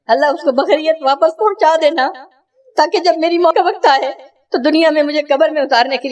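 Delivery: mother's prayer. Acted. ماں کی دعا بیٹے کے لیے.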